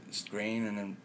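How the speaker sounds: ordinary talk, nothing marked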